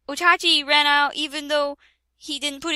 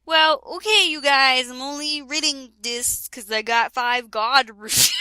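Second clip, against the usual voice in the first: ordinary delivery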